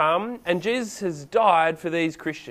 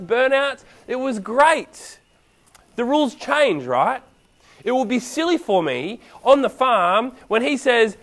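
normal talking voice

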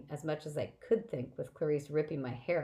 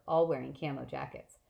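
usual speaking voice

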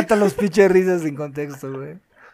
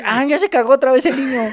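mock parent; 7 semitones higher, 6 dB louder than usual. Playing parent in house game.

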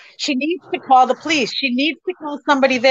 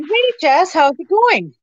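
higher-pitched, casual hello to a daughter; retold phone greeting; 5 semitones higher, slower than usual. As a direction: pick up the phone with an upbeat lift.

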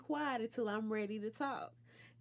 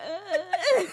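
mock crying whimper; 5 semitones higher, 6 dB louder than usual. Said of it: "That's not what she".

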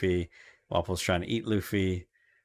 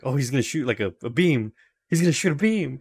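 excited Luffy; 5 semitones higher than usual. Retold or imitated. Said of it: "Luffy's like".

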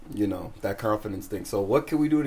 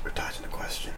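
teasing whisper, much softer; 8 dB quieter than usual. Real, not acted. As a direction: hiss the accusation under your breath. Teasing a dodging friend.

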